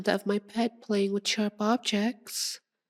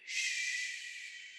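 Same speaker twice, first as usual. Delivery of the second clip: long, soft shush; soothing shush; 10 dB quieter than usual. Acted. Vampire hushes her pet resting on her legs, soothing. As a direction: hush them gently.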